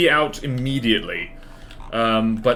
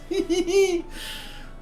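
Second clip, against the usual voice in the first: high laugh; 3 dB quieter than usual. Real, not acted. Laughs at own joke.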